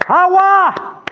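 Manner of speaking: high, drawn-out cry; shouting. Retold or imitated. Man commanding the rain to come, shouted. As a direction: call the rain down at full voice. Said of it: "empecé a gritar".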